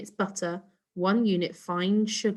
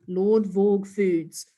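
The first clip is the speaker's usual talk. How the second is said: slow, booming announcer; advert voice; 4 dB louder, much slower than usual. Acted. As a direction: announce the brand like a TV ad.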